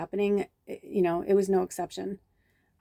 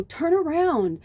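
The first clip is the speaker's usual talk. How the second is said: urgent call; 10 semitones higher, 3 dB louder, much slower than usual. Retold or imitated. Urging friend to look.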